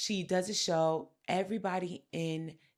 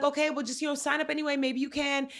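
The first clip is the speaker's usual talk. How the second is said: higher, coaxing and innocent; 8 semitones higher, faster than usual. Retold or imitated. [name] coaxing her dad to sign up, higher sweet innocent voice. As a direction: bait him sweetly. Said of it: "She's like".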